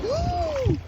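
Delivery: a sudden high yelp; high whoop. Real, not acted. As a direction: yelp of shock as the ground drops. Water deeper than expected.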